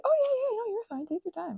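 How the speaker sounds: high, loud cheery clerk voice; imitating the clerk as a high, loud, eager helper; friendly clerk. Clerk quoted reassuring.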